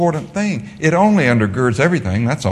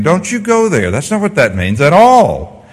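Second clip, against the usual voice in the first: ordinary delivery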